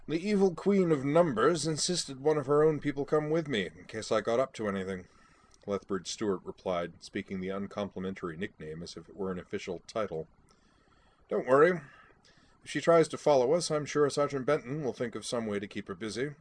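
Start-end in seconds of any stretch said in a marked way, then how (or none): 0.1-5.1 a lightly voiced old officer, louder; brisk, amused military gent; a hint of a bluff English brigadier; hearty British Brigadier. 11.3-16.3 a lightly voiced old officer, louder; brisk, amused military gent; a hint of a bluff English brigadier; hearty British Brigadier.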